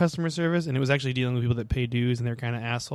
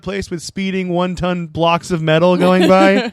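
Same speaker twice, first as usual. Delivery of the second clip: mock clueless parent; 5 semitones higher, 7 dB louder than usual. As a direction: lay the sarcasm on thick. Mocking careless parent.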